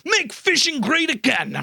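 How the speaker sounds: high-pitched mock protest chant; mock shout. Host imitates angry fishermen on the call, mock shouting. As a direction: imitate a protester shouting, without real volume.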